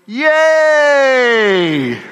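high, loud, long yay sliding down; demonstrated cheer. Shown as a demonstration. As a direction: lead the crowd in a big cheer. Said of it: "you say".

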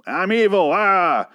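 hammed-up high evil villain cry; mock a dumb evil villain; cartoon villain. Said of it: "It's not just a stupid".

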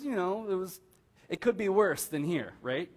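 normal talking voice

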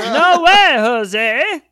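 very high, loud cartoon doctor squeal; Dr. Nick impression. Cohost repeating the line as a goofy doctor, very high. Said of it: "I don't even know what that was".

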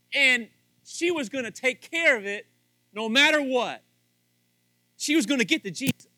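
loud, high, building sermon; preaching. Real, not acted. Pastor preaching to husbands at full force. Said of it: "I'm getting a little preachy there".

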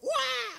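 high loud mock cheer of kids; a crowd of kids cheering, hammed up; imitated kids' cheer. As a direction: shriek it like a room of children.

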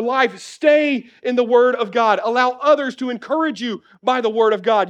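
high, driving sermon exhortation; fervent preaching. Real, not acted. Preacher charging Christians to stay in the Word, fervent. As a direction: build to an urgent charge, punching key words.

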